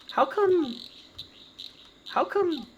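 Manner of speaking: put-on high-pitched child's question; puzzled children. Children staring at the goblin ask why, childlike and puzzled. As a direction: start very high, like a puzzled kid. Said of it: "and they say".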